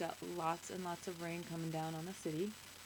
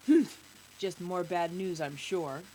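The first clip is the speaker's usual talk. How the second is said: put-on grumpy old man; 2 semitones lower, 8 dB louder, slower than usual. A narrator's reading. Old man to the news guy, grumpy. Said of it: "Where the man says".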